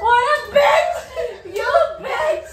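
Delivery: laughing shout. Real, not acted. Friend lost, laughing outburst.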